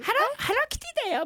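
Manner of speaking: high, fast squeaky mascot voice; Hello Kitty voice. Retold or imitated. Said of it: "みたいな".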